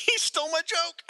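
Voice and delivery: real high-pitched laughing outburst. Real, not acted. Co-host beaten to his own joke, laughing protest. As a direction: crack up while protesting.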